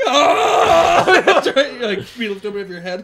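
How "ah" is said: high, loud laughing grunt; strained lifting shout. Pretend. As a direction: heave the box with a yell.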